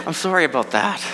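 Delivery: pitying inner voice, spaced out; mock sympathy. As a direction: wince and offer condolences in mock pity. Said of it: "my internal voice goes".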